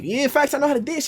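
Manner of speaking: high-pitched insistence; retold eager high-voiced yes; mock confident boast. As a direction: brag it up high. Boasting again to the girls.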